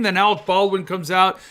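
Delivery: usual speaking voice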